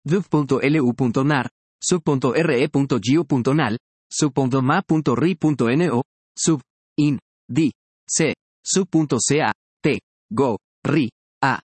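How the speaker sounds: sub, lu, nar and the other sub words split after sub; syllable by syllable. Shown as a demonstration. Teacher listing examples, one syllable at a time. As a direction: spell each word out in beats. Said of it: "delimitación silábica morfológica".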